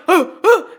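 high laughing yelp; mock yelp. Retelling her own scream, laughing yelp. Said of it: "Me going".

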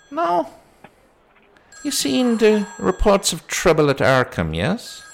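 high put-on policeman voice; sceptical policeman. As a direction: question her story in a high, doubting voice. Policeman questions her report.

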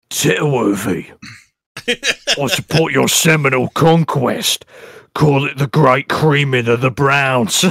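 slow, mock-pompous declaiming; put-on orator voice. Host reading Jack's post, slow mock-grand voice. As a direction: draw out each phrase like a grand pronouncement.

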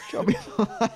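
words broken by a high real laugh; laughing too hard to get it out. Real, not acted. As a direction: crack up mid-phrase as you repeat the pun. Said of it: "Now I'm laughing".

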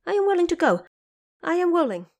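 high, loud eager yes; eager agreement. A narrator's reading. Monkey King eagerly accepts the Bodhisattva's offer, loud and high. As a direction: burst out willing, again and again. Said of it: "agreed over and over again".

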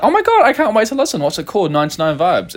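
high, excited and laughing; gushing fan. Retold or imitated. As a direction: gush like an eager fan. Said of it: "And like".

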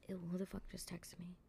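hushed whisper, annoyed; muttered whisper at an unwanted text; whispered aside. Real, not acted. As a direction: whisper it to yourself at the phone. Aside while checking a text.

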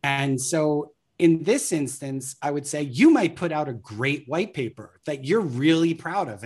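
ordinary delivery

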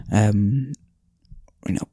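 ordinary delivery

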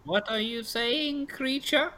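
put-on high-pitched old crone; old pastry seller. Old lady repeats herself to a customer, high crone voice. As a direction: question them in a high old woman's voice.

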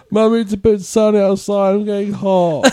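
high mock whine; hammed-up crybaby complaint; whiny crying. Mocking wimpy football players, high tearful whining. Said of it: "cry whenever they go".

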